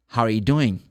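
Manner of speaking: "How are you doing" linked, "are" shrunk to a weak sound; casual connected "How are you doing"; reduced ARE. Shown as a demonstration. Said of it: "they drop the R".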